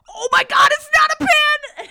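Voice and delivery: mock-awed Percy yelling; amazed shout. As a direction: shriek with wonder. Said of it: "and Percy's like".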